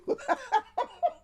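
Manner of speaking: a burst of high-pitched laughter. Real, not acted. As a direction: laugh out at your own joke. Laughing at her own joke.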